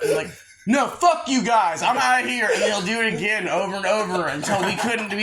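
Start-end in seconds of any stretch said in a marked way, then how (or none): 0.7-2.5 slow, loud, higher drunk imitation; mock a drunk storming off, dragged out; fucked-up belligerence. Drunk James walking out on his friends, imitated loud defiance. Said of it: "he'll look around all fucked up".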